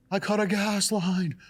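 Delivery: high, loud panicked yell; retold frantic cry. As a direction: shout it in a panic, stretched out. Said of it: "And he's, like, yelling".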